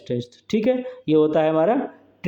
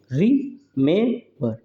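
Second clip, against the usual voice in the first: "Remember" slowly split as रि-में-बर; syllable stress demo; much slower than usual. Shown as a demonstration. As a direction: break "remember" into three separate beats for a class. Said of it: "stressed और unstressed".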